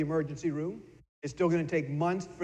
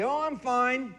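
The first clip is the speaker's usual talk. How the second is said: higher breezy "I'm fine"; fake-cheerful deflection; 7 semitones higher, slower than usual. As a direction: brush it off brightly. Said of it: "what fine stands for".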